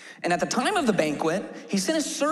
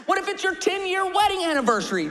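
preaching shout; 9 semitones higher than usual. Real, not acted. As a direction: shout the question to the crowd. Preacher's rhetorical build-up.

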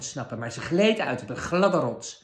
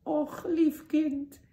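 put-on much higher, slow quavering old crone voice; trembling old woman; 5 dB louder, slower than usual. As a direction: quaver like a frail old woman hiding a wicked queen. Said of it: "met een bevende stem".

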